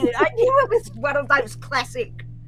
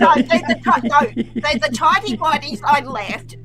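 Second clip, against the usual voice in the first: laughing; 4 semitones higher, 5 dB louder than usual. Real, not acted. Laughing denial.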